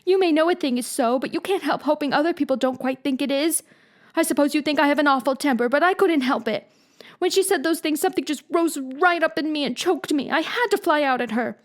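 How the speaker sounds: high voiced girl, hurt and pleading; a wounded child explaining herself; Anne, upset girl. A narrator's reading. Anne defending her temper to Marilla, upset. Anne's voice in story.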